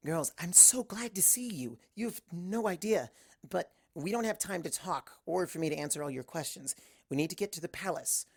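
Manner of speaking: a lightly voiced Twilight relieved to see her friends. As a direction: greet your friends with relief.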